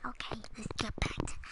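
whispering. Real, not acted. Whispered aside.

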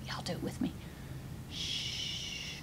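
hushed whisper and long shush; secretive whisper. Pretend. Storyteller shares a powerful secret, whispering.

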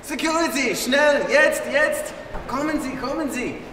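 high, agitated call for security; urgent calling out. Acted. German gamer calls security on a supposed volunteer, urgent calling out.

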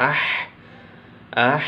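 "আহ" with a slightly hard h ending; firm ha. Shown as a demonstration. Teacher modelling ha for students, "আহ" with strong breathed h. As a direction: push the final h of "আহ" out firmly. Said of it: "হার উচ্চারণটা একটু শক্ত হবে".

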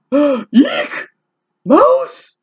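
high, loud squeal of fear; mock fright. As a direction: shriek it like a scared elephant. Elephant scared of mouse.

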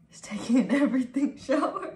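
loud, high, breaking into laughter; laughing. Real, not acted. Streamer jokes to viewers about his everything shower, laughing. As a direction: burst out laughing while saying it.

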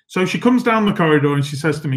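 normal talking voice

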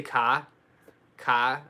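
"ka" with no R, open ah; Boston car. Shown as a demonstration. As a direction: drop the R in "ka" like a Bostonian. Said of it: "I do say".

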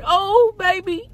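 husband's plea. Retold or imitated. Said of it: "and he said".

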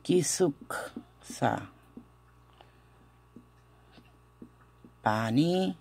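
slow, in separate pieces with pauses; pronunciation demo. Shown as a demonstration. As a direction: break it up slowly for learners. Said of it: "esta pronunciación es así". (pronunciación lenta, por partes)

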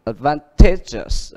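advantageous slowly, stress on TA; teacher's slow model of advantageous, TA stressed; stress on third syllable. Shown as a demonstration. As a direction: draw out advantageous, leaning on the TA. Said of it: "Vậy thì nó nhấn âm ở".

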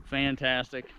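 normal talking voice

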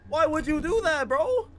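high, loud disbelieving outburst; why would you do that, stunned; shocked disbelief. Real, not acted. Shocked at the girl.

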